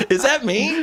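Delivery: laughing. Real, not acted. Laughing at being imitated.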